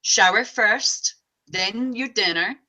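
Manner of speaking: slow, loud and deliberate; a firm mom laying down the rules; firm parent instruction. Shown as a demonstration. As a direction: spell it out firmly to the kid. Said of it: "Just say".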